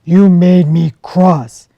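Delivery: loud, gruff big-bear voice; a big bear sending the fox away, loud and grumpy. Acted. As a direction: shoo her off like a cross old bear. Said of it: "he said".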